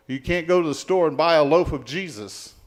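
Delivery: normal talking voice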